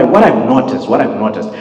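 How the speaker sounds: usual speaking voice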